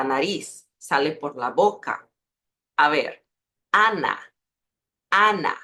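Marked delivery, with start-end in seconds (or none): 3.7-5.6 "Ana" with a fully open oral A, no nasal sound; Spanish open a. Shown as a demonstration. Teacher showing Portuguese speakers the Spanish A in "Ana". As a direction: say "Ana" with the air out through the mouth. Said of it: "Para que percibas la diferencia".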